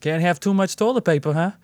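high-pitched mimicked Northeast accent. Shopper needling a man carrying toilet paper, imitated Northeast accent. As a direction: put on a Northeast lady's accent. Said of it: "my favorite accent to do".

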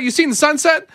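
high mocking voice on "You seen the sunset?"; mocking Albertan grammar. Co-host mocking a local phrase, high and teasing. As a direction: ham up the wrong "seen". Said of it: "it's like".

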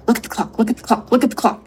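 insistent repetition, growing stronger; retold panicky command, building slightly. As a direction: push each repeat a little harder. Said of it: "it got louder and louder and louder".

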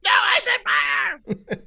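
high, very slow yell, near laughing; mock angry shout. Answering the Fred Meyer joke, a hammed-up angry shout. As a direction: drag it out furious. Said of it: "so mad when he yelled it".

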